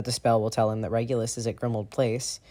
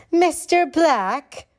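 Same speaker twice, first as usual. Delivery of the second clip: high, slow, loud scolding; stern librarian; 8 dB louder, much slower than usual. A narrator's reading. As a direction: bark it like a strict librarian.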